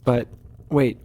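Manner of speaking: ordinary talk, nothing marked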